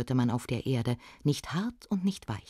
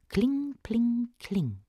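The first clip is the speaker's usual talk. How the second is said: high, drawn-out clinking, falling away; sing-song anvil ring; ringing hammer chime; 7 semitones higher, much slower than usual. A narrator's reading. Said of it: "klang es vom Hügel her".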